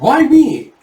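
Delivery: high, loud indignant whine; indignant protest. Retold or imitated. Complaint at rough treatment in story.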